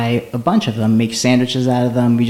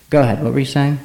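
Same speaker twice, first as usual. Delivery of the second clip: ordinary talk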